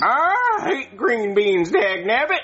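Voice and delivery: put-on slow, high country-sounding kid; Jeffy. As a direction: badly imitate a puppet child with a rural twang. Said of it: "Why does Jeffy sound country?".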